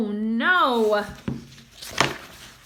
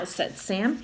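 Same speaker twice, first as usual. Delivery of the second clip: ordinary delivery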